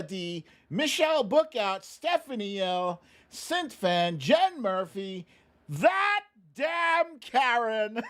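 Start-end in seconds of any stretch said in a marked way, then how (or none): 5.7-8.0 laughing. Real, not acted. Reading a funny username with laugh.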